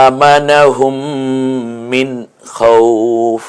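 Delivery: খাউফ chanted slow, ending clipped on the consonant with no -in; stopping pause form. Shown as a demonstration. Teacher demonstrates the pausal form of খাউফ, recited. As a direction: chant খাউফ and stop dead on the last letter. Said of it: "খাউফিন না হয়ে আমরা বলবো খাউফ".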